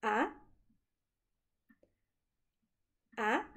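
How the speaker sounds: A sliding upward like a question; A in Chinese second tone, climbing. Shown as a demonstration. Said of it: "deuxième ton qui monte".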